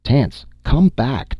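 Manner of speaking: slow, high-pitched call; shouted. A narrator's reading. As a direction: call him back from a distance. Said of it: "Dorley shouted".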